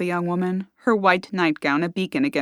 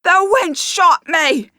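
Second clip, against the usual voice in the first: slow, much louder and higher scream of a wounded man; injured thug screaming for his gang; 12 semitones higher, 7 dB louder, much slower than usual. Acted. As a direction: yell it out, stretched, in pain and rage. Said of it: "he screamed".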